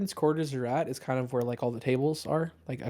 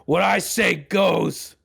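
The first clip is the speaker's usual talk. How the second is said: slow, loud, higher captain's shout; captain yelling; 6 semitones higher, 6 dB louder, much slower than usual. Acted. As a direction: bark the order down at him. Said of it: "you hear the captain yell".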